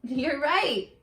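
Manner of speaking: ordinary delivery, nothing marked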